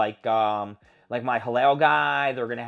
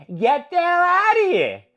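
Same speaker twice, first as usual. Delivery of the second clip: high, loud and slow, dragging it out; angry shout; 9 dB louder than usual. Real, not acted. Fan ranting at the Funko Freddy mascot, angry drawn-out shout. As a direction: bellow it at the mascot in disgust.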